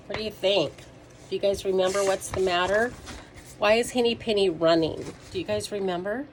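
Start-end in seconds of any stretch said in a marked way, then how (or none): none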